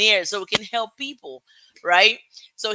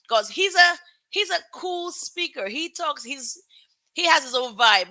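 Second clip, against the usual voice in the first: excited praise; 7 semitones higher than usual. Real, not acted. Host hyping the guest.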